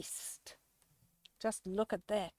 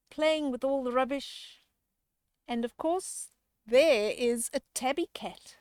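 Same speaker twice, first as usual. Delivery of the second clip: usual speaking voice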